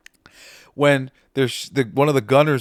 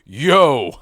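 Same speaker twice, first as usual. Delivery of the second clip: loud, drawn-out group reply; crew calling out; 3 semitones higher, 10 dB louder than usual. Acted. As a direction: call out like a crew answering roll call. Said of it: "And they all say".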